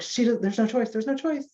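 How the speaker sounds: lightly voiced, insistent mom; insistent mother. Mother overruling her child about taking meds, insistent.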